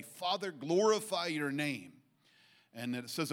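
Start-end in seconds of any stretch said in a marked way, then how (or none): 0.0-1.9 raised voice, drawn out and higher; public prayer as Jesus. Acted. Jesus praying to the Father before everybody, loud and slow. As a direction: pray it out loud for the whole crowd. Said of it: "Jesus says in front of everybody".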